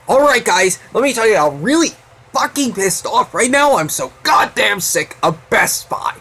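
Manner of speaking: fed-up reviewer venting at the camera; Cinema Sickness impression. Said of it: "That's my impression of Cinema Sickness".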